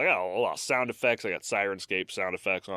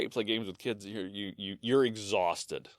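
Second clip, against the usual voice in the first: usual speaking voice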